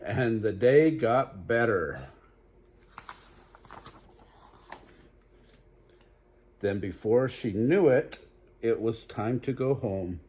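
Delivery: lively storybook read-aloud; animated bedtime story voice; reading to children. Acted. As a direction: read it bright for little listeners. Reads picture book aloud.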